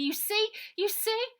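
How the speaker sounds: put-on girl squealing with happiness to her friend; overjoyed. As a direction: squeal it with delight. Ida overjoyed hugging Baby.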